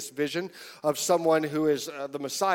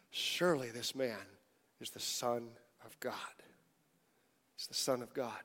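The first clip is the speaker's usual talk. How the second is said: hushed awe; 6 semitones lower, 13 dB quieter than usual. Retold or imitated. Disciples in awe after storm.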